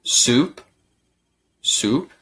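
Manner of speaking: Soup said twice with a clear "oo"; U sound in Soup. Shown as a demonstration. Teacher has learners repeat, Soup with a clear "oo". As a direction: model the "oo" vowel in Soup, leaving a pause between. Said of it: "Sí, tiene la sonida".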